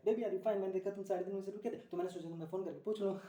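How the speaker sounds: hammed-up worried girlfriend; fussing girlfriend. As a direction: gush it like a clingy girlfriend on the phone. Acts girlfriend's anxious phone call.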